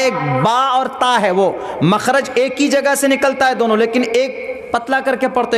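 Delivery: usual speaking voice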